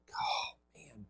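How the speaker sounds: unvoiced whisper of letdown; whispered oh man; whispered disappointment. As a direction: breathe it out in a whisper, deflated. Disappointed at no work.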